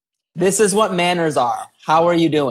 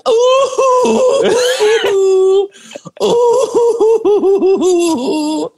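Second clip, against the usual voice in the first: high mock boohoo wails; fake crying; 4 dB louder, much slower than usual. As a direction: wail like a fake crybaby. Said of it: "boohoo crying".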